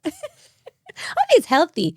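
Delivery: real laugh spilling into the words. Real, not acted. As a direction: still laughing while saying it. Laughing at own joke.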